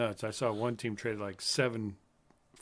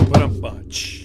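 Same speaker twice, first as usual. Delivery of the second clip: mock voice; 2 semitones higher, 15 dB louder, much slower than usual. Jokey put-on voice.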